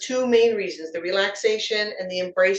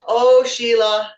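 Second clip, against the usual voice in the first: slow, louder mock-scolding oh Sheila; long teasing sigh of the name; drawn-out teasing oh Sheila; 2 semitones higher, 8 dB louder, slower than usual. Real, not acted. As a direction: stretch it out like a playful scold. Teasing the speaker's switch.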